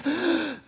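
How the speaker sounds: sharp high intake of breath; the big audible breath again; imitated on-air gasp; loud gasp. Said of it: "That's his breath".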